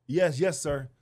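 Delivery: loud eager yes sir; eager crowd shout. Retold or imitated. Crowd answering comedian.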